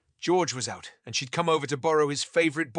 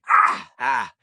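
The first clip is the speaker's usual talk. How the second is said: mock shouting; 8 dB louder than usual. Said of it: "someone shouting".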